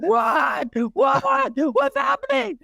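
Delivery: imitated high, panicky confusion; jolted out of sleep, mock alarm; startled awake. Husband woken by his wife, startled. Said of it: "He's like".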